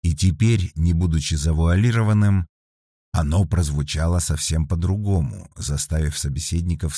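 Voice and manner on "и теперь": usual speaking voice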